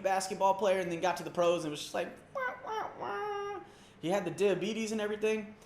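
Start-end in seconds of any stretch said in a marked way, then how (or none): 2.3-3.6 high, soft fake wailing; mock crying. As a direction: whimper like a crybaby. Mocking a player crying.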